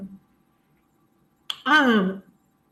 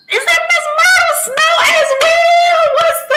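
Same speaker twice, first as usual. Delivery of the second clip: high, loud excited shout of recognition; 7 dB louder than usual. Real, not acted. Greeting a viewer by name, a thrilled shout. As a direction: cry out to a favorite viewer.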